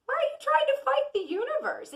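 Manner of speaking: incredulous. Real, not acted. Asks viewers why they fight the universe, incredulous squeal. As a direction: squeal the question in amazed disbelief. Exasperated rhetorical question to viewers.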